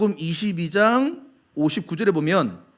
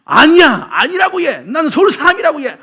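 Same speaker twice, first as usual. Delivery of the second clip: loud, high denial in Busan dialect; Busan accent; 8 semitones higher, 7 dB louder than usual. Acted. Peter denying everything like a Busan native, Busan accent. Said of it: "부산 사람 말투로 뭐라고 말하냐".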